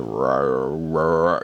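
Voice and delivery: low, slurred mock voice-changer rumble; disguised-voice imitation. Imitating anonymous voices edited for the phone room, low distorted mumble. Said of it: "C S I voice".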